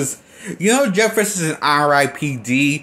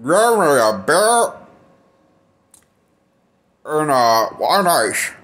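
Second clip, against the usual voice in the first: slow, mumbled drawl in an imitation of a gruff cowboy; Jeff Bridges impersonation; slower than usual. Reviewer mimicking the actor's unintelligible line, slurred mumble. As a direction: slur through it like a mumbling old marshal. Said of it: "this is my Jeff Bridges impersonation".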